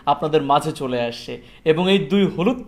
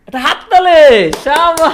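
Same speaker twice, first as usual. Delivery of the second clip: loud, high cheer of praise; excited praise; 8 semitones higher, 9 dB louder, slower than usual. Real, not acted. Praising a child.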